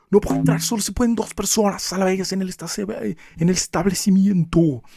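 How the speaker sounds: high, sharp scolding of customers; señora gritona. Retold or imitated. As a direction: mimic the grumpy old lady shouting at clientes. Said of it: "Pero les gritaba". (imitando a la señora gruñona que regaña a los clientes)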